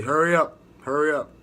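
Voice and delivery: loud barked hurry up; shouted hurry up; mock drill yell. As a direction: yell it like a drill instructor rushing recruits. Drill instructors rushing recruits.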